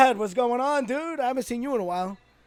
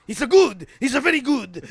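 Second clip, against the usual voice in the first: louder, clipped broken-English impression; broken English imitation; 5 semitones higher, 6 dB louder than usual. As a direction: mimic a Japanese commentator's halting English. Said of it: "And Yoshi was like".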